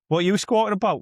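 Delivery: high-pitched, lightly acted heckle; mock challenge to crow. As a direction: playfully have a go at a noisy crow. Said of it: "Or there's a crow going".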